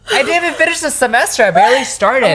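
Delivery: high-pitched, fast retold protest; indignant protest. Student protesting to dad about the semester, high-pitched indignation. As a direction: protest in a high, incredulous rush.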